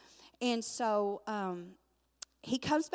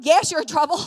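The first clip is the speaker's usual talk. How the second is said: high, very loud retort; loud mock scolding; exasperated yell; 6 semitones higher, 13 dB louder than usual. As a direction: holler it back at the kids. Grandma snaps back at the girls.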